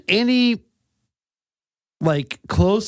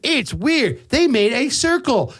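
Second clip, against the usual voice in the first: astonished farmer; 5 semitones higher than usual. Acted. Acting out astonished farmer at crop circle.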